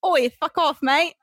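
badly faked British, very Australian accent; Australian-sounding British accent. Friend retrying British for laughs, landing in Australian accent. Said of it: "That was so Australian".

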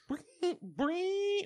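high imitated gadget noise; night vision whirr. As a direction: squeak out the goggle sound. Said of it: "all you would just hear is".

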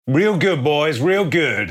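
loud, high-pitched, drawn-out praise; gang boss hollering. Acted. Gang boss congratulating his boys, loud hollering. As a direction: holler proudly to your crew. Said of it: "And he says".

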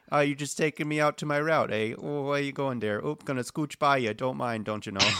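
exaggerated northern Midwest accent. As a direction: imitate a chatty Wisconsin local. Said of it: "Oh, you sound just like".

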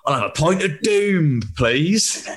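lightly voiced old bloke ordering. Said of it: "an old bloke go to the bar".

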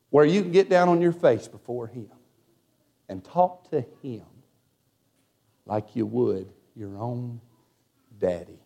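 hushed, dropping low, with long pauses; tender reverence. Real, not acted. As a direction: let it sink softly toward an intimate close. Sermon closes on intimacy with God.

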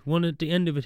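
ordinary talk

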